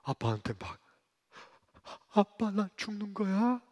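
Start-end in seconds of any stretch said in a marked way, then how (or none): 2.1-3.6 put-on frightened child. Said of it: "무서워서".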